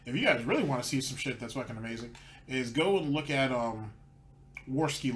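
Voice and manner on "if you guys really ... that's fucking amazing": normal talking voice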